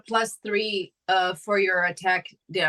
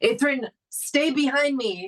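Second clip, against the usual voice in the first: higher-pitched call to a companion; urgent call; 7 semitones higher than usual. Acted. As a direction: call out a protective order. Calling out to a companion.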